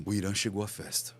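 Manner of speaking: low, softened statement; changed intonation. Shown as a demonstration. Speaker demonstrates how tone changes a message, low and soft. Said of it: "Se eu falar assim".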